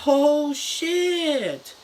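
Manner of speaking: high, put-on alarmed yelp; mock alarm. Nurse seeing the captive turn into a monster, alarmed. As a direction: squeal it in sudden fright.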